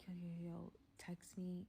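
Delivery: urgent whisper into the phone. Retold or imitated. Calling her friend from the restroom, whispering. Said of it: "I'm whispering".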